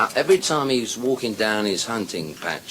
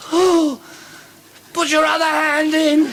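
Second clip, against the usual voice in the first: woman in ecstasy; 5 dB louder than usual. Acted. Woman demanding more of her lover, high ecstatic cry.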